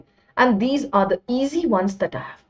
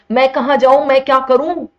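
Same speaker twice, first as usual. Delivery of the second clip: loud, high-pitched playful exasperation; mock despair; 6 semitones higher, 7 dB louder, much faster than usual. Teacher confused by students' names and genders, mock despair. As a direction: wail it in hammed-up helplessness.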